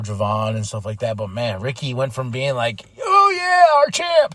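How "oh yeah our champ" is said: high, loud cheering for a hero; crowd cheering. Retold or imitated. Fan cheering their champ in a high loud shout.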